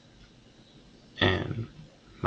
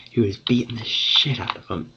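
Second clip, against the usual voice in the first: usual speaking voice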